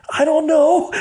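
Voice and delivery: high, half-laughing mock apology; almost apologetic. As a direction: retell it as a high-pitched, flustered protest. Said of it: "I'm almost apologetic for telling him this".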